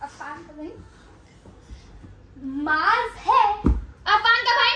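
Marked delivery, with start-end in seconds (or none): 0.0-0.7 whispering. Acted. Whisper challenge, whispered phrase.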